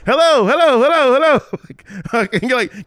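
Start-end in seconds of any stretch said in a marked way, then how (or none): 0.1-1.4 high, loud, sing-song hellos; hammed-up squeaky hello; mock greeting from the womb. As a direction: call out cheerily like a tiny voice knocking.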